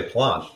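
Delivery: low plant with long "ah"; long-vowel PLANT. Shown as a demonstration. Answering how they say plant, with long "ah". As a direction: plant as "plahnt". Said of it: "How do you say it".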